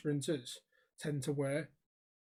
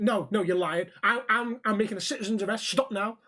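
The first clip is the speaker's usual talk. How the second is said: loud, higher, self-important command; retold angry order; 4 semitones higher, 5 dB louder than usual. Stranger making a citizen's arrest, mock-angry. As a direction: imitate an irate busybody taking charge.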